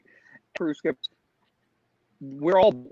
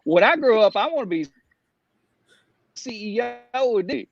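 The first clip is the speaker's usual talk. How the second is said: put-on young [name] voice; 7 semitones higher than usual. Boy announcing his dream to his mom, young [name] voice. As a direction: put on a higher little-boy voice. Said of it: "I love your young [name], [name] voice".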